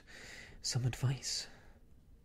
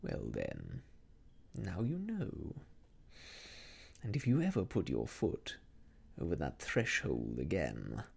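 smiling villain; 8 semitones lower than usual. A narrator's reading. Rucastle voiced by reader.

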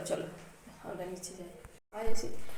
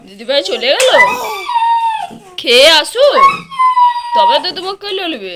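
put-on high, loud sing-song coaxing the baby; loud, lilting baby talk to the infant; 9 semitones higher, 13 dB louder than usual. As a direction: coo brightly up at the little one. Mother coos at the baby.

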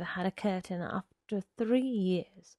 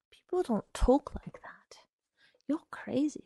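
frightened whisper under her breath; muttered; 5 semitones higher than usual. A narrator's reading. Connie mutters in fright at Arnold Friend, whispering. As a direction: breathe it, barely voiced, scared. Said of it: "she muttered".

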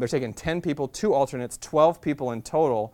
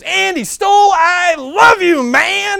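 ecstatic shout; 14 dB louder, much slower than usual. Retold or imitated. Guy running down stairs greeting a friend, thrilled shout. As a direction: shout it high and loud, overjoyed. Billy runs over shouting excitedly.

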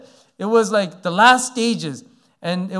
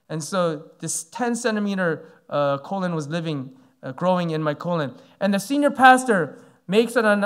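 ordinary talk

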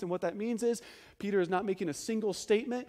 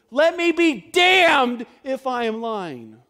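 thundering, drawn-out sworn denial; vehement oath; 5 semitones higher, 15 dB louder, slower than usual. Acted. Preacher acting out an accused man swearing a curse on himself, vehement shout. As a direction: shout it slowly, with full force.

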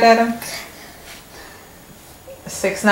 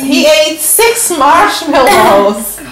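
amazed; 5 semitones higher, 3 dB louder, much slower than usual. Real, not acted. Mother marvels, slow and high.

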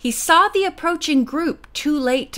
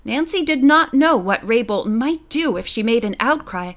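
usual speaking voice

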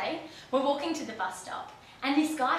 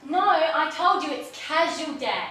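protesting shout; 9 semitones higher, 11 dB louder than usual. Acted. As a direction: shout back at mum in exasperation. Shouting back at mum, protesting.

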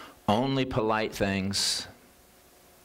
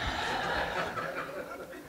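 breathy chuckle after the punchline; a quiet laugh at the joke; soft amused laugh; real soft laugh; 7 dB quieter than usual. Real, not acted.

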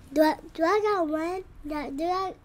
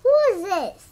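demanding; 5 dB louder than usual. Real, not acted. Demanding caller identity.

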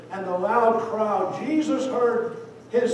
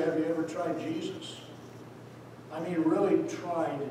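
hushed appeal; 2 semitones lower, 7 dB quieter than usual. Real, not acted. Preacher's quiet personal appeal.

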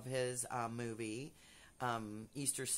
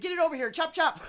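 very high, loud, laughing command; bossy fan yelling at the comedian, laughing; bossy shout; 12 dB louder, faster than usual. Retold or imitated. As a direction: order him around, laughing. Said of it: "I said".

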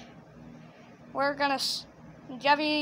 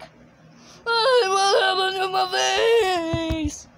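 high, loud puppet wail, slowly drawn out; Jeffy; 5 semitones higher, 5 dB louder, much slower than usual. Shown as a demonstration. Director demonstrating Jeffy interrupting the scene, loud high character wail. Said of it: "Jeffy interrupts with a weird face, going like".

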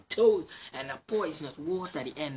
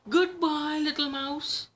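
much higher, hasty farewell; a bird flustered and leaving; Owl voice; 13 semitones higher, 5 dB louder than usual. Acted. Owl leaves the mouse, high flustered farewell.

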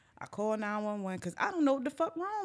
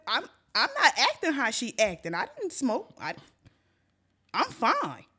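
higher, animated insistence; defensive protest; 4 semitones higher, 5 dB louder than usual. Retold or imitated. Storyteller insisting to responders they are sober, defensive. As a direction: protest innocence with swooping pitch.

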